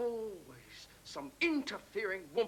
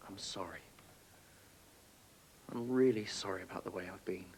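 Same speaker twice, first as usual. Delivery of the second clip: remorseful apology; 8 semitones lower, 5 dB quieter than usual. Acted. Father apologizes, subdued.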